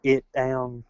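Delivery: imitated foreign attempt at English; broken foreign English. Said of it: "like a foreigner trying to speak English".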